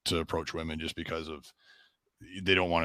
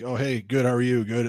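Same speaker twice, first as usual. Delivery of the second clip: higher, louder breezy hello back; friendly reply; 6 semitones higher, 6 dB louder than usual. Retold or imitated. Answering a greeting while walking on, friendly and upbeat. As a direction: imitate an upbeat passing reply. Acting out a polite passing reply.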